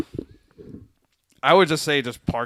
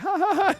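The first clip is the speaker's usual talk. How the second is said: mock laugh. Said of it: "we just start laughing".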